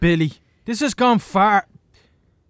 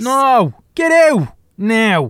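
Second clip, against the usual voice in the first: loud, higher, slow order to get out; angry shout; 4 semitones higher, 6 dB louder, slower than usual. Acted. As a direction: bark him out of the room, slowly. Man throws boy out.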